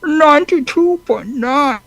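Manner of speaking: high, drawn-out character voice; Bane. Acted. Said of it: "can you say that in a Bane voice".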